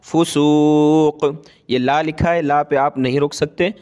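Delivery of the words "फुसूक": फुसूक drawn out slowly and stopped cleanly on the final k; recited pausal form. Shown as a demonstration. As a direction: stop on फुसूक with a long held vowel. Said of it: "यहाँ सांस टूटा तो कैसे वक़फ़ किया?".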